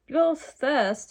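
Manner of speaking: put-on high, loud girlish exclamation; acted scream. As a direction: shout it out, hammed-up but not full scream. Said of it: "Titania screamed".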